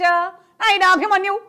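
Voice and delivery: high, loud anguished calling for a lost son; Mayabazar mother's lament. Retold or imitated. Mimic recreating the Mayabazar scene, calling Abhimanyu high and loud. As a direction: cry out for your son across the battlefield. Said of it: "Mayabazar చూసావు కదా".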